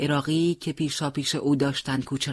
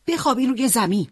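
urgent warning shout; 8 semitones higher than usual. Acted. Mortar whistles, commander yells warning.

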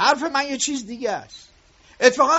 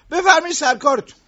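louder, sharp send-off; curt dismissal; 4 semitones higher, 6 dB louder than usual. Acted. Boss sending the worker away, irritated. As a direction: shoo him back to work.

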